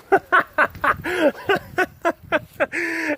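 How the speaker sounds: long high-pitched laugh. Real, not acted. Amused at faking that time has passed, giggling. Laughing at his own illusion.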